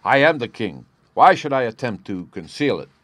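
forceful outburst from a king; crying out. A narrator's reading. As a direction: proclaim it in agitation, dropping all pretence. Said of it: "he cried".

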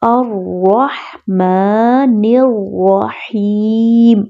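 الرحمن الرحیم slowly, ha breathed from the throat; slow chant of الرحمن الرحیم; clear ha. Shown as a demonstration. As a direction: mind the ha in الرحمن. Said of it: "ہا کی آواز کا بہت خیال رکھیں".

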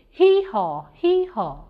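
loud braying donkey hee-haw; shouted hee-haw. Acted. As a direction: bray it out like a donkey. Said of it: "Dan the donkey shouted".

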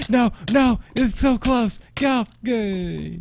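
high, urgent game panic; high-strung gamer urgency; frantic pleading. Real, not acted.

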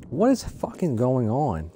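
ordinary talk

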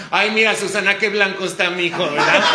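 loud, high mother's remark to Susana; loud, high mother exclaiming in the theater; mom's loud comment. Retold or imitated. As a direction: call it out loud like a mom in the audience. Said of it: "oigo la voz de mi mamá".

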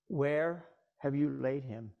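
troubled. A narrator's reading. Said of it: "he groaned in the spirit and was troubled".

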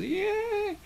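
soft, high mock cheer; faraway fan's cheer; small wave cheer. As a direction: squeal a little pretend "yeah" softly. Cheer passing along the wave.

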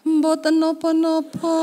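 slow, sweet exaggerated Javanese wife voice; put-on polite Javanese. Wife waking husband in mock-polite Javanese. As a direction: drawl it out like a dainty Javanese wife. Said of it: "aku koyo wong Jawa".

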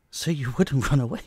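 laughing. Acted. Teasing captive bound in bed.

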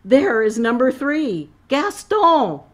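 high, loud excited cry; excited gasp. A narrator's reading. Designer spots her model, crying out excitedly. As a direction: call out thrilled at the find. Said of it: "she gasped".

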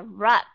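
"rup" stressed with a full short U; clearly pronounced. Shown as a demonstration. Teacher contrasting the strong syllable with the weak one, clearly. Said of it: "Chúng ta đọc rõ ra".

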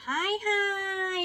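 high, slow drawn-out hi hi; sing-song hi. Real, not acted. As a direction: stretch each hi like a cute wave. Sing-song greeting to viewers. (ทักทายแบบลากเสียงสูง)

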